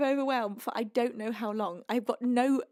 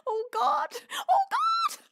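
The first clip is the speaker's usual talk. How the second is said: crying wail; slower than usual. Real, not acted. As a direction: break into overwhelmed sobs. Huge donation, sobbing overwhelm.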